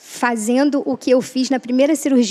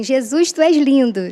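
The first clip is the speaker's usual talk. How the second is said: her catchphrase; 4 semitones higher, 6 dB louder than usual. Retold or imitated. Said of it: "É o, é o meu bordão".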